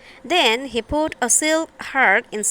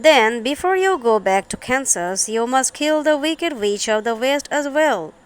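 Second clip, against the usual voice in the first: ordinary delivery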